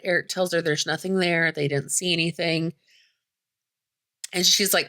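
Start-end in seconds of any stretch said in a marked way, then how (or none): none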